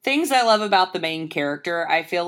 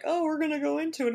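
mocking eager voice; 11 semitones higher, faster than usual.

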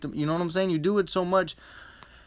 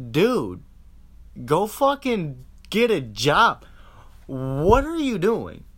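louder, slow drawn-out scolding; body scolding; 2 semitones higher, 5 dB louder, slower than usual. Retold or imitated. The body scolds its owner to get a job, loud and slow. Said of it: "your body's like".

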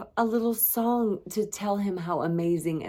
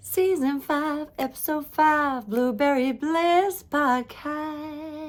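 high, slow announcer call; drawn-out grand announcement; 10 semitones higher, 5 dB louder, slower than usual. Acted. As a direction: belt out the show title like a host. Announcing the show title.

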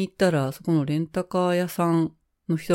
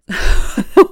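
laugh; 4 semitones higher, 10 dB louder than usual. Real, not acted. Gets a gift of bananas.

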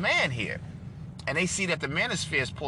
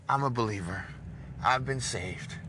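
ordinary talk

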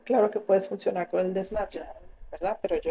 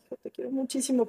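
soft, quick plea; 8 dB quieter, faster than usual. Retold or imitated. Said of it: "a las cuatro de la mañana le dije".

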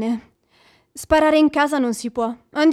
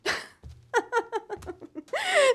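scornful burst of laughter, trailing down; incredulous mocking laugh; 6 semitones higher, 10 dB quieter than usual. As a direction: laugh him off as absurd. She mocks his confession.

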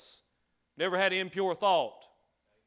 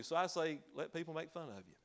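ordinary talk, nothing marked

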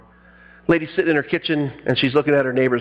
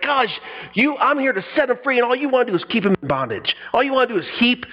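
mildly exasperated, pitched up and rushing; frustrated Jesus; 8 semitones higher than usual. Retold or imitated. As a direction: vent lightly as a fed-up teacher. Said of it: "Jesus was so frustrated".

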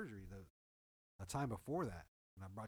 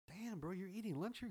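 surprised; 9 semitones higher than usual. Retold or imitated. Retelling surprise at coworker's lunch.

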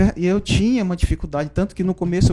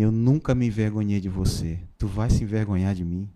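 inner voice of God; 7 semitones lower, 4 dB quieter than usual. Retold or imitated. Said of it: "Uma voz dentro de mim".

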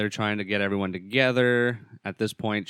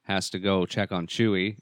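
ordinary talk, nothing marked

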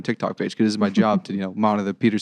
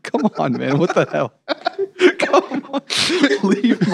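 mock outrage; 10 semitones higher, slower than usual.

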